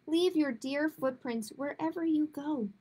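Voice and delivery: usual speaking voice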